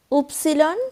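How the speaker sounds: উপসিলন said as German "üpsilon" with a rising end; name Y the German way, উপসিলন; German letter Y. Shown as a demonstration. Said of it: "জার্মানরা বলে উপসিলন". (German উচ্চারণে উপসিলন)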